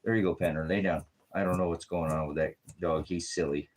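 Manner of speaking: usual speaking voice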